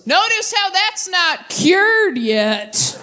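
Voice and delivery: high, very slow sarcastic dig; snide jealous dad. Retold or imitated. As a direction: drag out a jealous jab. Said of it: "my dad goes".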